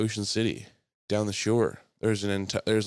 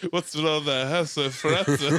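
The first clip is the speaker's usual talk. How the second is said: laughing, louder mock Yiddish accent; 5 semitones higher, 4 dB louder than usual.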